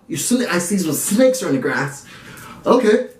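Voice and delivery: lightly voiced frantic houseguest; hammed-up meltdown over a betrayal; manic Rachel. Vlogger mimicking Rachel in the have-not room, mock frantic. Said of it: "exorcism or an outer body experience".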